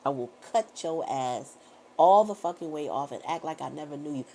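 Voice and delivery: ordinary talk, nothing marked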